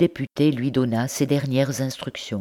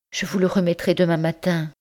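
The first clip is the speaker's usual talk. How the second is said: higher, mildly trembling promise; en frissonnant; 5 semitones higher than usual. Acted. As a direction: promise it while frightened. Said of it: "dit Blanche qui frissonnait". (jeune mère tremblante qui négocie, légère peur)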